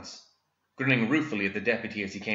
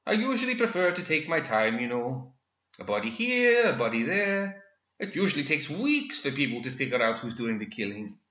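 much higher, smug gentleman villain; gloating villain; 5 semitones higher than usual. Acted. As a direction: taunt with polite menace. Villain explains his killings.

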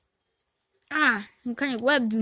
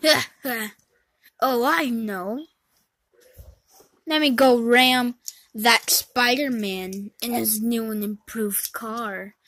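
ordinary talk

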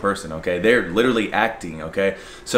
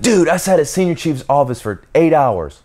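complaining recruit; 7 semitones higher, 3 dB louder than usual. Retold or imitated. Friend complains to narrator.

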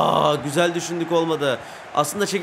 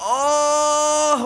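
anguished cry; 9 semitones higher, 3 dB louder than usual. Real, not acted. Near miss in game.

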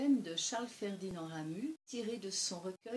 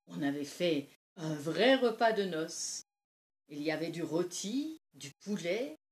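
lightly acted old woman, animated by festive memories; old villager reminiscing; 10 dB louder than usual. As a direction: relive the harvest feast, swelling on the highlights. Old Lise recalls harvest feast. (vieille conteuse qui s'anime, légèrement jouée)

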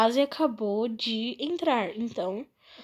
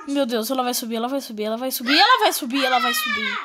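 being chased; much faster than usual. Real, not acted. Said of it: "ela tá atrás de mim".

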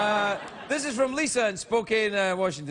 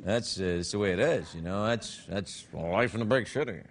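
much lower, softer gruff wise-guy voice; tough city guy; 13 semitones lower, 4 dB quieter than usual. Acted. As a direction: growl it like a world-weary mobster.

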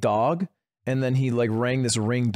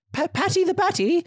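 high, surprised recognition; surprised woman. Retold or imitated. Woman recognizing Paddy on camera, high surprised voice. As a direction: voice the woman realizing who it is.

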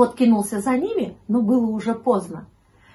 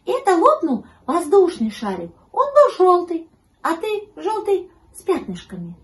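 high, loud explaining kitten; high-pitched kitten voice; Гав; 9 semitones higher, 6 dB louder than usual. A narrator's reading. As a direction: explain as a piping little kitten. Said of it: "объяснил щенку котёнок Гав".

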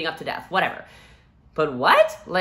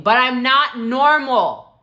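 high, loud, slow protest through tears; pleading cry; 8 semitones higher, 10 dB louder, slower than usual. Retold or imitated. Patient protesting to the doctor, high and loud in disbelief. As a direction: cry out that she is not like the others. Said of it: "putting my head up and saying".

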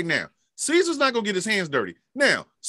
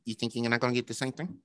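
low, soft stammering tough-guy threat, quick at the end; menacing Pesci-style squirrel, hushed and low; Joe Pesci; 6 semitones lower, 8 dB quieter than usual. Retold or imitated. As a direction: lower and softer mobster stammer. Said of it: "Joe Pesci ass little motherfucker squirrel going".

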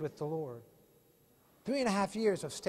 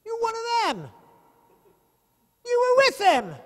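much higher and louder, pointing him out; accusing shout; 12 dB louder than usual. Retold or imitated. Bystander exposing Peter by the fire, loud high accusation. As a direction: call him out to the crowd.

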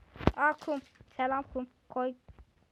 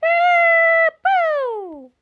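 high shouted nonsense cry; high, loud shout; shouted silly yell; 17 dB louder than usual. Real, not acted. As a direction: belt out a goofy high yell. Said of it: "bağırarak söylemeyi çok seviyorum".

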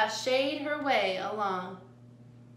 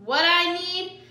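ordinary delivery

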